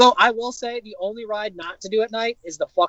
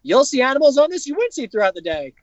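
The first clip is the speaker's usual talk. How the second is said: higher, hammed-up tour guide pitch; mock safari guide; 7 semitones higher than usual. Friend mocking the night safari guide's line, higher hammed-up pitch. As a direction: sell it like a bored park guide doing the script. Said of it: "So they're like".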